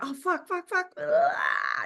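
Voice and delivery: sudden high, panicked burst of swearing, breaking into a gasp. Retold or imitated. Actor hit by shame mid-scene, panicked high swearing. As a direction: caught off guard by shame, swear in alarm. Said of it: "and then you're like".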